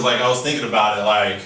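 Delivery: ordinary talk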